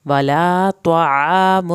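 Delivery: stretched tajweed recitation; Quran recitation. Shown as a demonstration. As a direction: chant it out slowly with a full heavy ṭā. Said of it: "طوا پڑھنا ہے".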